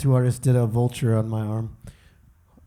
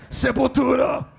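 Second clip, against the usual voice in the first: mock metal shout; 10 semitones higher than usual. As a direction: holler it like a metal fan. Shouts band name after tattoo joke.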